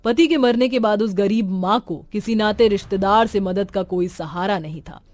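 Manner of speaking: plain reading voice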